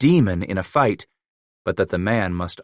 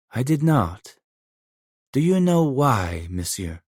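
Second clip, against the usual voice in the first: put-on lightly French-accented broken English, a touch higher; Emile's French accent; 4 semitones higher than usual. A narrator's reading. Trapper Emile telling Leclerc about his mother, lightly French-accented.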